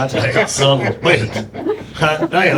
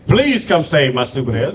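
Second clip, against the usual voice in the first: usual speaking voice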